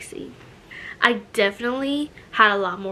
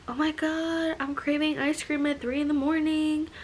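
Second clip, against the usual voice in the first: higher-pitched dramatic self-quote; mock craving urgency; 5 semitones higher, 4 dB quieter than usual. Pregnant woman announcing a late-night ice cream craving, mock dramatic. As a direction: act out a sudden, over-the-top craving. Acts out her past cravings.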